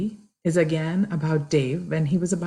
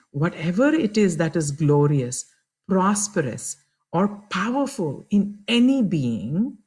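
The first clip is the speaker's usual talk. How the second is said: ordinary delivery, nothing marked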